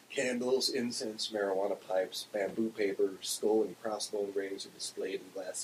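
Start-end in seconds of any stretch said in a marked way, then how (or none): none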